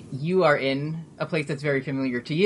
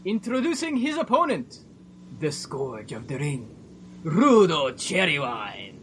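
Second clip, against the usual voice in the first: wrestling announcer. Acted. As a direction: announce the challenger, stretching out the name. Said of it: "you hear an announcer's voice".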